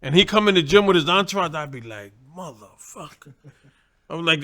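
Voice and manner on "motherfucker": much softer, muttered curse under the breath; dreading mutter. Retold or imitated. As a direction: grumble it so nobody in the gym hears. Dreading the sparring partner arriving.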